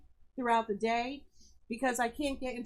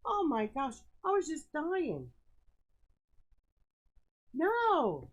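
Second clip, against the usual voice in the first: ordinary talk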